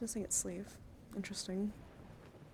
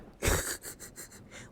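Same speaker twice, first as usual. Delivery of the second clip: rapid chuckling burst; real chuckle. Real, not acted. Streamer laughing at a silly chat comment, chuckling. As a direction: chuckle helplessly.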